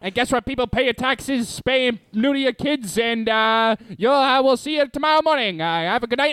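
put-on high old-timey Mid-Atlantic showman; Mid-Atlantic sideshow hype; Mid-Atlantic accent. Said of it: "best Mid-Atlantic impression".

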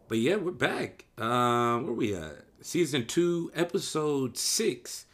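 high, loud and hyped; excited. Real, not acted. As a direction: pump up the return. Excited return to show.

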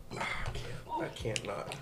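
gasping through burning mouth; breathless, pained from the heat; mouth burning from spicy food. Real, not acted.

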